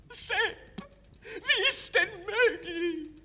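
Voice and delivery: shrill anguished mother crying out. Acted. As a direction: wail it high and loud. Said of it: "erblickt ihren verwundeten Sohn und schreit auf".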